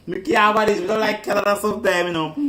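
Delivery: high, loud, quick Jamaican patois; mock patois complaint. Jamaican woman joking about Canada in high, loud patois. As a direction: throw it out in broad Jamaican patois. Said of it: "I use fucking big words that tie up my mouth".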